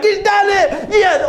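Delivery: high, ecstatic shout; screamed acted triumph; cheering. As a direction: holler it like heaven's biggest fan. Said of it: "everyone's cheering".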